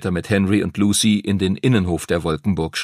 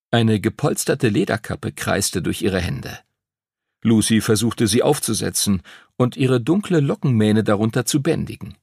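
plain reading voice

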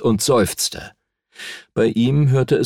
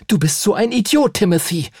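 1 wütendes Mädchen; 11 semitones higher than usual. A narrator's reading. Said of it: "funkelte ihn wütend an".

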